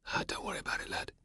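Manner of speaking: put-on whispered comfort to the boy; soft whisper. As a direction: hush it kindly, no voice.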